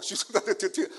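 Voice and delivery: usual speaking voice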